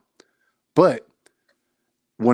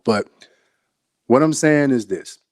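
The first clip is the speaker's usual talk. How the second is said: ordinary talk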